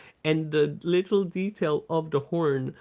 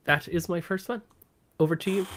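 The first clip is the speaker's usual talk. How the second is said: ordinary delivery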